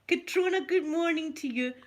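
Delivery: mock drugged chirp; imitating high-on-morphine daughter. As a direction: mimic her woozy cheerful hello.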